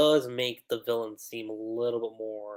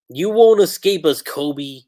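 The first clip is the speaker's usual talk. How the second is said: loud villain taunt; 2 semitones higher, 10 dB louder than usual. Acted. Villain line acted loudly.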